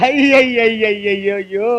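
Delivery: slow stretched lament; slow comic moan of despair; drawn-out wail. Acted. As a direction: pull the ayyo out into one long groan.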